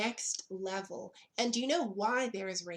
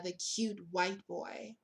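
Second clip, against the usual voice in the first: ordinary delivery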